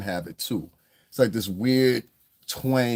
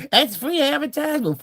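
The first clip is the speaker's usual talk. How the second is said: high, squeaky mock voice, much higher; mocking impression. Vlogger mocks YouTubers who think any mention is free promo, in a high mocking voice. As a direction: sneer it like a clueless clout chaser.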